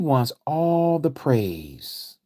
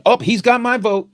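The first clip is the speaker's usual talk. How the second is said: much higher, louder gushing voter; mock eager voter; 10 semitones higher, 5 dB louder than usual. Preacher mocking voters won over by faith talk, high and loud. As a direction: gush like a fooled voter. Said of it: "Obama said".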